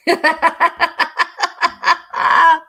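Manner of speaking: long, high cackling laugh; helpless high laughter. Real, not acted. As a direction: burst into a run of loud giggles. Laughing at own pronunciation.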